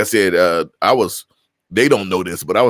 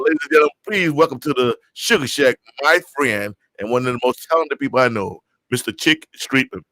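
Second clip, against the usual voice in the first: higher, showman's welcome to the stage; host presenting his friend to the crowd, announcer style; announcer intro; 4 semitones higher than usual. Acted. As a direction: bring him on like an emcee.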